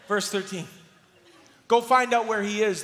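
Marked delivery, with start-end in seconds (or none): 1.7-2.8 loud, higher command; the king sending soldiers after Elisha, loud command; ordered. Acted. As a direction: bark the order at his men. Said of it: "the king ordered".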